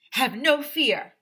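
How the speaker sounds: big slow superhero boom; heroic declaration. Acted. Custodian bursting in to save the day, booming. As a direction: announce it like a caped hero.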